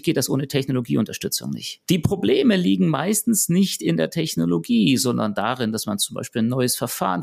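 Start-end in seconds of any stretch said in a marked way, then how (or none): none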